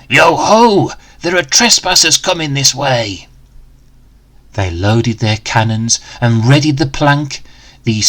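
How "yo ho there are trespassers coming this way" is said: lightly voiced gruff pirate lookout; a bored pirate spotting intruders. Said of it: "heard one of them say".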